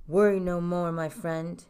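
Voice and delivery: low, reassuring king; deep royal assurance; kingly voice. A narrator's reading. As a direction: pronounce it as a monarch, starting loud. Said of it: "replied the king".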